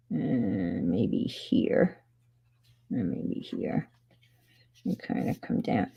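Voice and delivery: murmured under the breath while concentrating; whispering. Real, not acted. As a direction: drop to a whisper as you focus. Quiet focused marking.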